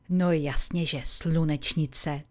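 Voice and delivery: ordinary delivery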